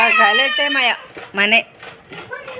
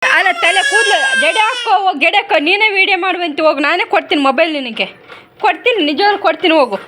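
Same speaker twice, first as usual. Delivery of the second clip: raised coaxing over crying; 6 semitones higher than usual. Real, not acted. Mother urging child over crying.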